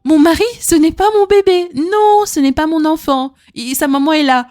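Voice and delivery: high, loud, insistent retort to a husband; high, loud retort to a fussy husband; emphatic retort. Retold or imitated. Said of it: "Je vous disais".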